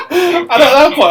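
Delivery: high and loud while laughing; laughter spilling into the words. Real, not acted. Barber still laughing at the crawling customer.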